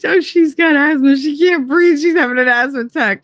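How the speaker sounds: high, fake-innocent cover story; lightly mocking innocence; fake innocent explaining. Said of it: "I was like".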